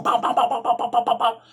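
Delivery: rapid high "bow, bow" punch noises; imitated punches. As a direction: rattle off each blow. One-on-one fight, punches imitated.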